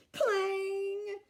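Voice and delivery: high loud yelp of pain; yelping shout. A narrator's reading. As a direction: yell as a tooth breaks. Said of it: "shouted Cuchulainn".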